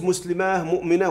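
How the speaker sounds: مسلماه مؤمنة ending in -aah, no t; pausal h for plural. Shown as a demonstration. Teacher showing the rarer option, مسلماه مؤمنة ending in long -aah. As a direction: say "muslimaah, mu'minaah" for مسلماه مؤمنة. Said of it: "ويجوز".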